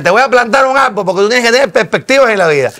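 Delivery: high, loud Cuban accent imitation. Telling school friends in a Cuban accent. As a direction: put on a lively Cuban accent. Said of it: "¿Cómo imitas eso? ¿Tienes familia cubana?".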